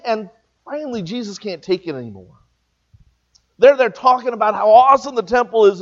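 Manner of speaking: usual speaking voice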